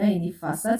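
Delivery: ordinary talk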